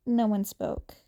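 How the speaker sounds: ordinary delivery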